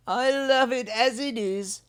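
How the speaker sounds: a bilby content with his stone; loving Hunwick. Acted. Hunwick to himself.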